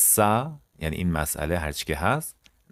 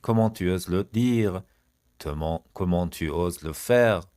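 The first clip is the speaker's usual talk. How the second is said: each syllable drawn out slowly for learners; slow model phrase; much slower than usual. Shown as a demonstration. French teacher to students, slow model read. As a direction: say it slowly and clearly.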